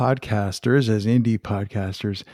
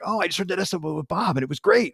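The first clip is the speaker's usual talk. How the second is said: eager listener; 7 semitones higher, faster than usual. Retold or imitated. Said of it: "they'd be like".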